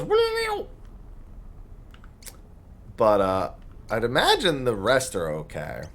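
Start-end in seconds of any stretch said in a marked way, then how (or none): 0.0-0.7 very high-pitched squeaky "wee-meow" noise; Cube. Acted. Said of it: "Cube goes".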